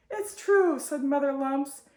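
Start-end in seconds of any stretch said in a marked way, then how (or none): 0.1-0.8 upset. A narrator's reading. Mother insists child is gone.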